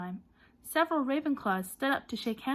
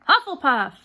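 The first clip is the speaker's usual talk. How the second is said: hat's announcement; 5 semitones higher, 13 dB louder than usual. A narrator's reading. Sorting hat announces house.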